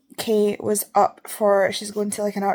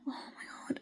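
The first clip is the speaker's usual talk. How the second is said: whispered gasp; 19 dB quieter, slower than usual. Real, not acted. Sudden realisation.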